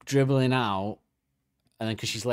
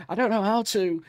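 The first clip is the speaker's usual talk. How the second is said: panicked squeal; 9 semitones higher than usual. Pretend. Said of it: "You're going".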